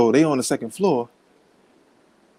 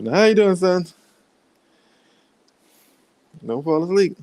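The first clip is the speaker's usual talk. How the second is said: put-on higher, louder, coaxing the baby awake; imitating wife to son; 6 semitones higher, 4 dB louder than usual. Wife keeping the baby awake, higher coaxing voice. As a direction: play the wife cooing at the sleepy son. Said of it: "That's my wife".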